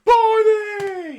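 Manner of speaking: high, loud, drawn-out shout; acted shout. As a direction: holler it out, stretching the end. Acting out Barney bursting into life.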